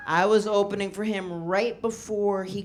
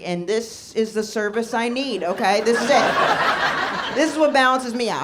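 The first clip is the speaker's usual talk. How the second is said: louder, pushy insistence; lying defensively; 4 semitones higher, 6 dB louder than usual. Retold or imitated. Lying to landlord about service dog, insistent. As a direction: bluff hard to the landlord. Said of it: "I was just like".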